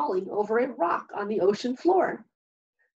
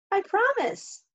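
much higher little crab voice; small crab voice; 10 semitones higher than usual. A narrator's reading. A smaller hermit crab asks Hermit Crab for a home, high and small. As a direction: speak up like a small young crab.

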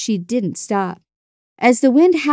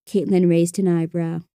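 plain reading voice

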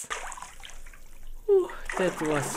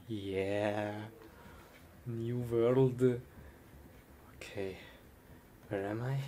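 normal talking voice

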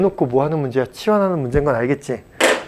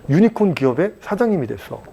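ordinary talk, nothing marked

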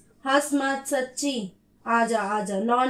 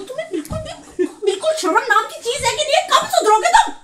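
angry yelling; 9 semitones higher than usual. Real, not acted. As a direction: yell at him in outrage. Wife yelling at husband.

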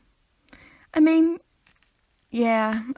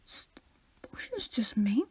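breathy hush; whispering; 3 semitones lower, 9 dB quieter than usual. Real, not acted. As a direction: whisper it sulkily. Whispered sulk.

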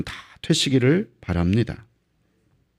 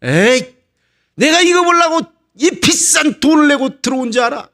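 high, shouted indignant complaint; acted furious outburst; angry spectator; 10 semitones higher, 3 dB louder than usual. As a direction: storm out as a fed-up paying fan. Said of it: "화가 나니까 떠나버립니다".

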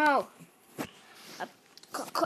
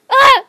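high ticklish scream; tickled shriek; 10 semitones higher, 18 dB louder than usual. Real, not acted. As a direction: shriek while being tickled. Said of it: "Don't tickle me".

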